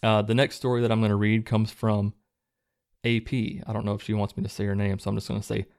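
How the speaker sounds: ordinary talk, nothing marked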